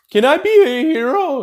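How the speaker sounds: high, pleading little-hero voice; voicing Deku begging his hero. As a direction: beg the idol for permission. Voicing Deku asking All Might.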